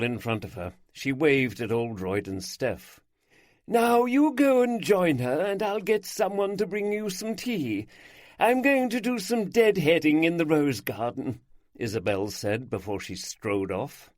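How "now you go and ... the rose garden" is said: brisk and a little higher; a hint of a busy older lady; lightly voiced bustling hostess. A narrator's reading. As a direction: send the guests off cheerfully. Isabel speaking in the story.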